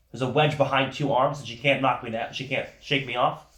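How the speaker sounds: ordinary delivery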